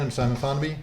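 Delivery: plain reading voice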